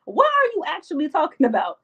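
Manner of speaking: high, fast, indignant squeal; mock outrage. Speaker playing herself answering a friend, high indignant mock outrage. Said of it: "And I would be like".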